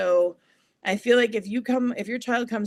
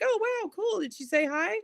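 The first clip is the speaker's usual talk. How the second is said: put-on very high, impressed talk to a child; pitched-up, kid-directed wonder; encouraging parent; 12 semitones higher than usual. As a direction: play along with a little one. Acts out adult's reply to child.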